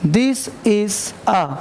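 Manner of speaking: "this is a" drawn out very slowly; very slow demo. Shown as a demonstration. Teacher slows right down for students to listen. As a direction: stretch "this is a" syllable by syllable. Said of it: "अगर ऐसे बोला जाए, समझना गौर से".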